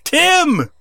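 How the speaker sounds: high-pitched imitation of a desperate call; mock scream. Poor wrestler calling after his angry tag partner, mock scream. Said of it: "He's, like, screaming for him".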